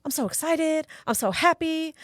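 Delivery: put-on high-pitched little boy voice, happy; imitating excited son. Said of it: "Santino was getting ready for bed, and he's like".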